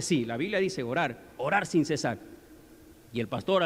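ordinary delivery

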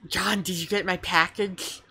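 lightly voiced bubbly girl; a hint of a happy-go-lucky girl. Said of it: "what was Garden Gnostic's voice again".

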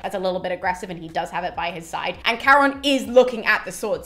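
ordinary talk, nothing marked

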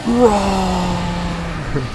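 high, loud shouted roar; gleeful roar. Real, not acted. Taunting the trapped opponent with a loud roar. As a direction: yell it like a war cry. Shouting spell name in fight.

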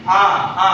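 teacher models আ, open mouth and tongue in the middle; vowel demo. Shown as a demonstration. Teacher shows the class how আ is said. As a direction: hold a clear open আ. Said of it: "যখন আ উচ্চারণ করছি".

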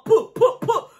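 high, popped gun sounds; playful mock gunfire; imitated gunshots. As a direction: pop out single shots with your mouth. Imitates slow gunshots of bullets.

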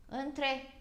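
ordinary delivery

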